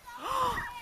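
high, playful shocked gasp; dramatic gasp. Pretend. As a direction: gasp as if alarmed, for a child. Dramatic gasp about lost brother.

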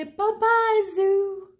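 high, loud sing-song bye-bye; bye-bye to zoo. Shown as a demonstration. Teacher leads kids saying bye to the zoo, high and loud. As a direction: wave goodbye in a high chirpy voice.

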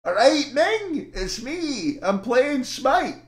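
high, drawn-out caricature voice; Paul. Acted. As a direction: do a slow, high-pitched mocking impression. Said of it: "here's my best Paul impression".

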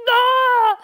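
loud, high pretend sob cry; mock sobbing wail. Host pretends she'll burst out crying on the ship, loud high mock wail. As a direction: wail like you're overcome with tears. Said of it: "And I'll be like".

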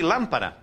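lámpara with LAM stressed; stress on first syllable. Shown as a demonstration. Teacher giving students the correct lámpara, first syllable stressed. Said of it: "Está faltando acento em lámpara".

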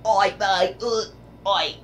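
hammed-up English colonist voice; cartoonish English accent, slow on good religion. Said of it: "they're like".

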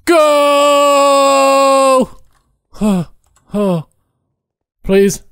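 high, loud frantic yell; panicked cry. Real, not acted. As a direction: scream it as the alien closes in. Fleeing alien, urging partner.

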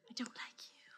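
secret unvoiced whisper; whispered aside. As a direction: hiss it under your breath. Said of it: "Oh, Mister Brennan".

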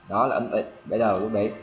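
ordinary delivery

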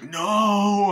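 long, high, loud cry of protest; mock scream. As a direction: imitate a mother crying out. Mother screams in retold scene.

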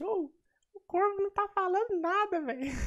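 very high, squeaky complaining voice; imitating chat viewers. Streamer mimicking chat about his silence, squeaky complaint. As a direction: whine it like a cartoonish fan. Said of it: "Aí os caras no chat".

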